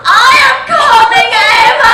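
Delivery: high, loud hammed-up climax cry; mock shout. Adolf crying out to his bride, hammed-up shout. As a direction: belt it out like a cheesy villain. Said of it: "Adolf shouted".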